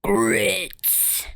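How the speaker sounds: drawn-out "Grits", voiced out of the whisper; playfully stretched, fully voiced word. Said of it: "Say it louder".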